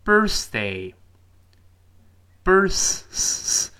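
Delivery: very slow, high "birthday" with a held "th"; drawn-out "th" inside "birthday"; clear TH demo. Shown as a demonstration. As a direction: stretch "birth" and hold the tongue-out "th". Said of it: "别忘记中间T H".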